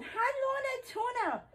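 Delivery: high sweet pet greeting; talking to a pet. Real, not acted. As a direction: chirp it up high to the cat. Greeting cat mid-squats.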